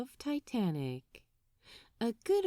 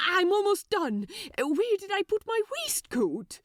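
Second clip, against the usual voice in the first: much higher, louder, fussing angel; flustered Aziraphale; 10 semitones higher, 3 dB louder than usual. Acted. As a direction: hunt for the waistcoat in a flap.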